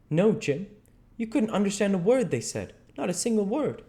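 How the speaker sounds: high, insistent dialogue voice; Huck, emphatic. Acted. Huck corrects Jim about French speech, emphatic.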